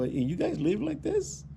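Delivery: high, slow, aghast question; mock horrified at the homeowners; shocked disbelief. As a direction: recoil in disgust as you ask it.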